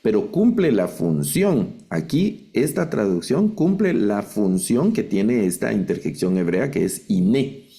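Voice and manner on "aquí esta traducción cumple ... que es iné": usual speaking voice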